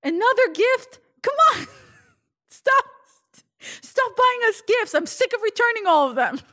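hammed-up yelling Russian dad, very high and loud; dad in the background. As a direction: holler like an exasperated father from the next room. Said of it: "you hear my dad in the background".

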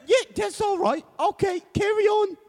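very high British official; squeaky mock British Governor; high-pitched Governor. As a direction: wave them on in a silly high British voice. Governor dismisses them in sketch.

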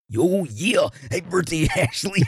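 very high, strained wrestler-style shout-out; strained high whoop breaking into laughter; Macho Man. Acted. Cohost singing out a listener's birthday wish, as Macho Man. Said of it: "Macho Man comes right out".

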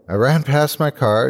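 ordinary delivery

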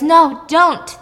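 high, loud acted objection; urgent protest. Host voices Dave stopping Chris from killing her, urgent protest. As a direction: cry out to stop them, retold.

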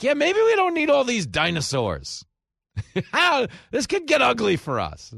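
high, loud, breezy know-it-all voice; careless scientist. Retold or imitated. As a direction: play a shrugging, reckless genius. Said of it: "who's like".

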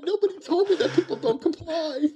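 high, laughing mock panic; laughing disbelief. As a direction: squeak it out in amused shock. Rookie shocked by the fight.

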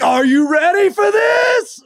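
high, loud squealed taunt; mock girly cheer. Girlfriend greeting the dad at the door, in a high squealing taunt.

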